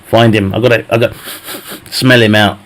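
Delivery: usual speaking voice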